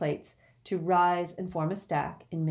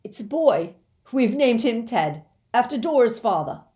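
loud, higher joyful announcement; cried aloud; 6 semitones higher, 8 dB louder than usual. Acted. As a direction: burst out with the big news. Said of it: "then cried aloud".

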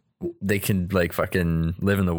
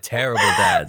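mock outrage; 4 dB louder than usual. Real, not acted.

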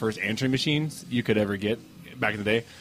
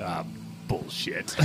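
low, hushed, imitated old grumble; grandfather's grumble; 8 semitones lower, 11 dB quieter, much slower than usual. Grandson replays grandfather's taped message, low irritated grumble. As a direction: imitate a grandfather muttering in disgust. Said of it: "I've heard my grandfather".

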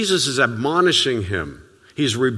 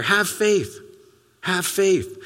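a lightly voiced, higher, encouraging Jesus; gentle Jesus; 6 semitones higher, slower than usual. Jesus encouraging Peter, lightly voiced, higher and gentle. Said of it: "It's Jesus saying to Peter".

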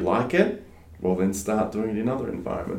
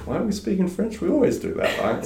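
put-on childlike quote; 11 semitones higher than usual. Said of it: "turned around and said".

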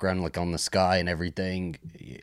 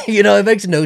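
laughing; 13 semitones higher, 12 dB louder than usual. Real, not acted. Laughing at the movie while telling a friend. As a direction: burst of laughter, much higher and louder.

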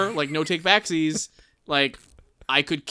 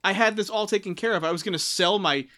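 higher, louder, hammed-up indignant whine; exaggerated panicked outrage; mock outraged rant; 6 semitones higher, 3 dB louder than usual. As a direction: rail at the big ape over lost money. Professor Porter voiced in outrage.

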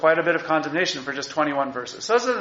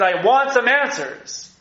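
higher, slow, indignant demand; 7 semitones higher, slower than usual. Retold or imitated. Teacher voicing Habakkuk protesting to God, slow and indignant. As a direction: lean in and insist on an answer. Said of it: "Habakkuk is furious at God".

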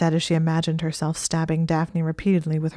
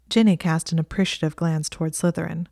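ordinary delivery, nothing marked

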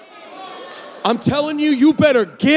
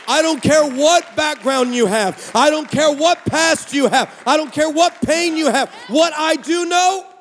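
full-force preaching; 5 semitones higher than usual. Acted. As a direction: preach it, rising with each line.

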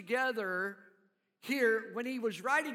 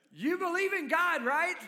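lifted, louder coaxing question; higher questioning Jesus; 7 semitones higher, 5 dB louder than usual. Retold or imitated. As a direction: ask it brightly, higher and louder. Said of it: "he was asking".

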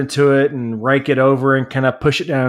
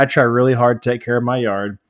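normal talking voice

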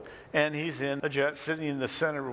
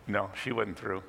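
ordinary delivery

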